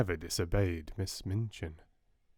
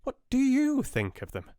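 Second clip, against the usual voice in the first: high, flustered spinster voice; timid sister reporting, high and fluttery; fussy Miss Amelia; 3 dB louder than usual. Acted. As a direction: sound a bit alarmed and fussy.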